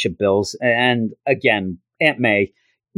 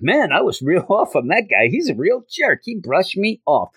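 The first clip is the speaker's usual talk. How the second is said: higher-pitched mock indignation; snubbed complaint; 7 semitones higher than usual. Classmates grumbling after Peter walks by, lightly acted indignation. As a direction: lightly act out a miffed classmate.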